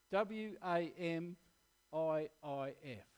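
letter by letter, slow; spelled out slowly. Shown as a demonstration. Preacher spelling an acronym slowly, letter by letter. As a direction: spell it out for the congregation. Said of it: "It's an acronym".

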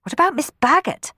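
anxious. Acted. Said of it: "asked Sophie anxiously".

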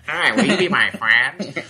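high, slow, loud nasal voice; whiny nasal plea, drawn out. Retold or imitated. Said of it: "you got a cool, like, kinda deep voice".

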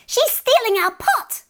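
very high-pitched, loud outcry; shrieking. A narrator's reading. Fairy men spotting the old woman's theft, shrieking. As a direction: shriek the alarm in outrage. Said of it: "they shrieked".

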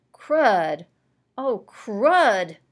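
loud pained cry of crud; boy hit in the nose by a ball, crying out in pain; pained yelp. A narrator's reading.

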